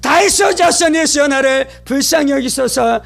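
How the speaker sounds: high-pitched repeated plea; urgent crying out. Retold or imitated. Blind Bartimaeus springs up calling to Jesus, crying out. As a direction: launch each appeal high, as if bursting out. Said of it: "그가 막 외치기 시작하는 것입니다".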